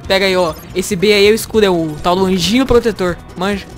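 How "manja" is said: usual speaking voice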